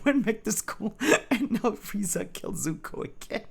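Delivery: squeaky, helpless amusement; laughing recap. Real, not acted. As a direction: recap it while cracking up. Reader laughing while recapping story.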